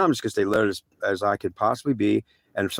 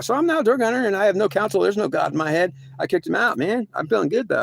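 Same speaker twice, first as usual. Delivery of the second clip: giddy bravado; 9 semitones higher than usual. Retold or imitated. Boasting about kicking God out.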